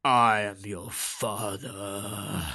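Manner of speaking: low, slow looming Vader voice; Darth Vader impression. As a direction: pause after Luke, then sink deep and slow. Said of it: "that's how everyone remembers it".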